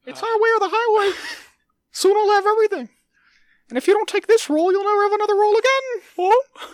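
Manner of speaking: loud, very high squeaky mouse; a greedy cartoon mouse, squeaking loudly; Mickey Mouse voice. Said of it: "Mickey Mouse Voice".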